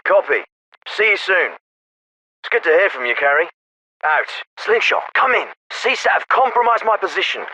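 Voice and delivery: military officer over a field radio; crisp orders through a narrow radio channel; radio transmission. Acted. Radio exchange with field team.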